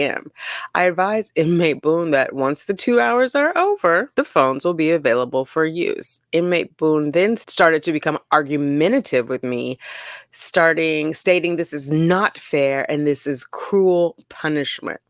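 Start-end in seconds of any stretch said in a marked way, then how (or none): none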